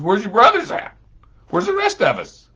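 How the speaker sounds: elder brother searching for the others, loud and high; alarmed asking. Acted. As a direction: ask with rising worry.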